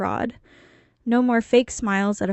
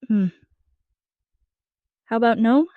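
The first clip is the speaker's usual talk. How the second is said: mock consideration; 3 dB louder than usual. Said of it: "I pretended to consider it".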